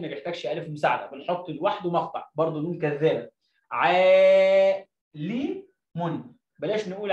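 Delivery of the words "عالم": loud held عا in عالم, then لي and مٌ apart; syllable sounding-out. Shown as a demonstration. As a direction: stretch the aa of عالم loudly, syllable by syllable. Teacher sounds out word by syllables. (تهجئة عالم بمد عالٍ ومقاطع منفصلة)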